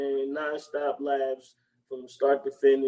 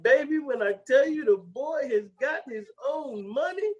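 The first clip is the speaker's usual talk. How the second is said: raised, put-on voice for the character; high voice imitation; 9 semitones higher than usual. Retelling a movie scene to a friend, high mock voice. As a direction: quote him in a high mock voice. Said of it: "he was like".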